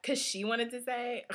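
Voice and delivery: ordinary delivery, nothing marked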